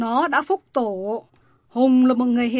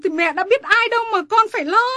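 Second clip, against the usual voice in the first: agitated retort; 9 semitones higher, 5 dB louder, faster than usual. Acted. Mother argues back.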